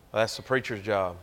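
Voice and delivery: ordinary talk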